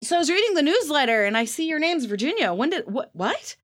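high, puzzled mock cousins; confused cousins. Cousins ask about her name Virginia, high puzzled voice. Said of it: "they go".

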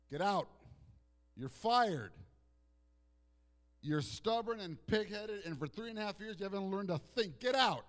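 loud, high mock firing of the disciples; pretend firing rant. Preacher pretending to fire stubborn disciples, loud angry rant. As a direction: bellow it like a fed-up boss. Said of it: "I would have said".

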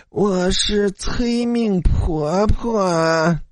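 high, very slow, drawn-out crone voice; ghostly crone. A narrator's reading. Ghost granny announcing herself to students, eerie drawl. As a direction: draw every word out eerily, pitched high.

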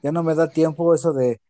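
ordinary delivery

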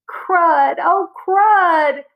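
high, loud yelp of pain at the hit; pained outcry. Acted. Boy hit on the nose by the ball, yelling in pain. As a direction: cry out as the ball smacks your nose.

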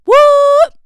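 high, very loud shocked yelp; mock shock. Interviewer hearing of no Marvel knowledge, mock-shocked yell. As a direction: explode in feigned disbelief. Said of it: "constantly be shocked at me".

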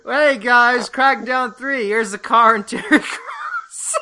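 high, loud hammed-up announcer voice through laughter; mock presenter. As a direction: ham up a cheesy game reveal host, laughing. Mocking an Xbox presenter.

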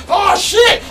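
very high, loud yelp; retold alarmed shout. Watching chairs fly in a brawl, alarmed shout. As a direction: yell it as chairs fly at you.

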